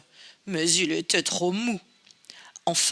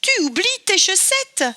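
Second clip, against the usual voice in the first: much higher, louder little bear shout; calling out; 11 semitones higher, 4 dB louder than usual. Acted. Baby Bear shouting to the fleeing girl, high and loud. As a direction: yell it after her across the distance. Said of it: "lui cria Bébé Ours".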